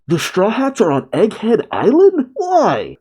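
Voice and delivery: hammed-up officer's alarmed outcry; mock yell. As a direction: cry out the questions, climbing to a squeal on the last word. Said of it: "one of the Marine officers yells out".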